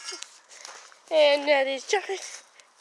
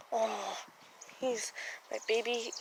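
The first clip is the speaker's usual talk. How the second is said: tender cooing; 6 semitones lower, 11 dB quieter than usual. Real, not acted. Cooing over the cat.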